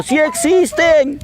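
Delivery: high, drawn-out insistent call; butterfly calling back. Acted. Butterfly leaving insists to the worm, high calling voice. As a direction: call it out high while flying off.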